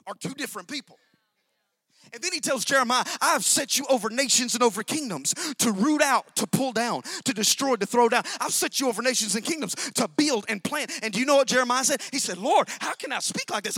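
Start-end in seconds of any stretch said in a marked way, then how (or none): 2.1-13.8 loud, surging pulpit delivery; full-force preaching. Acted. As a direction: drive the story home from the pulpit. Preaching Jeremiah's calling.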